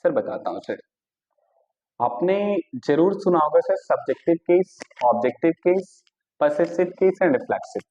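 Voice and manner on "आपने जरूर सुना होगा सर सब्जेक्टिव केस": usual speaking voice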